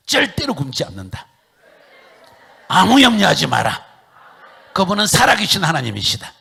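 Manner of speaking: high, very loud proclamation; preaching shout. Real, not acted. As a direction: belt it out from the pulpit. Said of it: "따라합시다".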